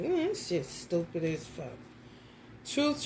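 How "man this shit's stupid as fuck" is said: low disgusted mutter. Retold or imitated. Beetle vanished.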